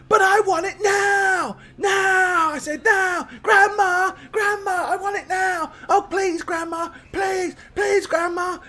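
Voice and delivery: put-on very high-pitched child tantrum; whining little girl. Little girl stamping and begging grandma, tantrum whine. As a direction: wail and plead on and on. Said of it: "you'll just hear her going on and on".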